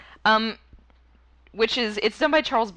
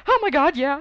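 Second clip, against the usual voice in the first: excited; 6 semitones higher, 4 dB louder than usual. Retold or imitated. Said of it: "I was like".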